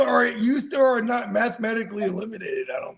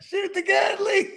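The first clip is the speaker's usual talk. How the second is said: shout; 11 semitones higher than usual. Real, not acted. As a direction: yell it to fire him up. Egging friend on.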